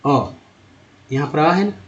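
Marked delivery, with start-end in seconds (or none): 0.0-0.3 अ as a short standalone vowel; isolated short vowel अ; vowel sound demo. Shown as a demonstration. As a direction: sound out अ on its own. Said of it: "कंपनी में को क्या बोला गया".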